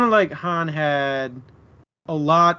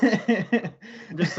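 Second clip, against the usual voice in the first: laughing; 6 semitones higher, 3 dB quieter than usual. Real, not acted. Laughing at the TikTok image.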